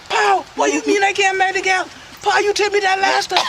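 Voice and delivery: high-pitched, wailing protest; exasperated high shout of complaint; outraged son. Acted. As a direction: cry out high at your pa. Son protests the second forbidden marriage.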